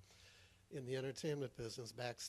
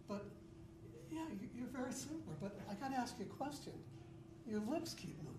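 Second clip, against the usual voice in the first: raised, animated comic voice; Ricky character voice; 9 semitones higher, 4 dB louder than usual. Acted. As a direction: play the bewildered guy at the mirror. Comedy monologue as Ricky.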